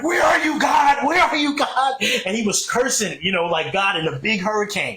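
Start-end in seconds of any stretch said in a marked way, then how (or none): 0.0-1.9 mock shout at God. Forrest Gump character cursing God in the storm, shouting. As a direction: yell up into the hurricane. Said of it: "And he was like".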